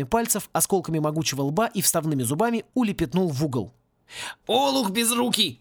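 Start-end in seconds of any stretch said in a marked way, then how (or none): 4.5-5.6 higher, drawn-out angry exclamation; thundering scolding. A narrator's reading. Caretaker to clumsy student after the crash, thundering. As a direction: roar an insult, slowly stretched. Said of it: "загремел над Гаттером голос Клинча".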